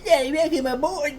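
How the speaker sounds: high-pitched attempt at a British baby; badly faked British baby. Trying to voice a British baby, high and failing. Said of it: "I can't do a British baby voice".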